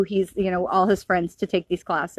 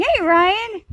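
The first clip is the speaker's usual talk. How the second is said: higher, louder call across the room; quick raised hey; 11 semitones higher, 5 dB louder than usual. Real, not acted. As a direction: call Ryan to get his attention. Calling to someone off-camera.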